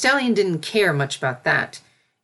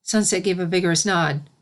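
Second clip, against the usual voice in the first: usual speaking voice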